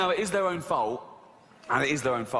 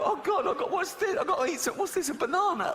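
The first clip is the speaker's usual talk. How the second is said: much higher, desperate, disgusted at fruit; hammed-up hungry whine; starving shopper; 11 semitones higher than usual. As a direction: whine at the banana like it's poison.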